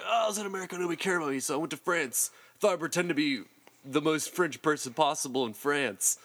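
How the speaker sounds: high-pitched mocking imitation; mock self-pitying retelling. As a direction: start high and whiny, easing down through the excuse. Retells fake Frenchman's sob story.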